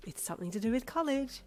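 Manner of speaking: high, excited prompting; squealed hint. A narrator's reading. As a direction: squeal it without shouting. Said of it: "she squealed".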